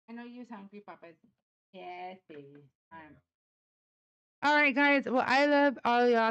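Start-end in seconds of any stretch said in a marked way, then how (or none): 0.1-3.2 soft cooing. Real, not acted. Owner soothing her hungry dog, soft coo.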